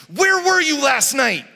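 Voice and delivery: loud, high scolding demand; accusing toddler. Retold or imitated. Little daughter scolding her parents in the morning. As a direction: jab the question like a finger. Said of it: "sticking it in our face".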